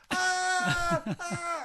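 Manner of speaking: high loud yelp, hammed up; mock pained cry. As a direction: yelp as the window hits. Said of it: "Bloke's like".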